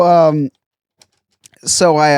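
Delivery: usual speaking voice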